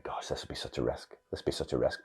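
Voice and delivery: soft whisper of inner doubt; whispered worry. Retold or imitated. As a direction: breathe the worry to yourself. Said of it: "I was thinking".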